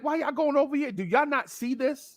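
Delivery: high-pitched, unraised mock plea; incredulous pleading. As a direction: act baffled, voice climbing. Pleads with ladies in the story.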